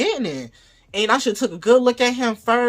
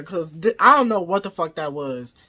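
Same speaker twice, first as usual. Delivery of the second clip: ordinary talk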